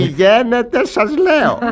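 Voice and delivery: commanding order. Acted. Duke orders his servant away, firm loud command. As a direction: send him off with authority. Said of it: "Τι τόνος φωνής".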